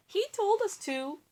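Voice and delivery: a hint of whiny knights; knights blaming. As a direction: point at the king.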